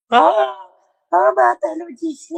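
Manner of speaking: high-pitched mocking imitation. Mocking imitation of the woman's cries. (voix aiguë moqueuse)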